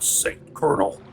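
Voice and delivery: rasping American action-hero voice; Snake. Acted. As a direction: growl it like a game soldier. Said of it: "two seconds away from Snake".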